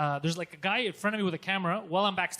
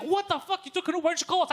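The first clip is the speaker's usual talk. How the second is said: high-pitched retold disbelief; shocked, rising to louder; incredulous questioning; 10 semitones higher, 4 dB louder, faster than usual. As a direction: react aghast as the staffer, voice up high. Staffer reacts in disbelief.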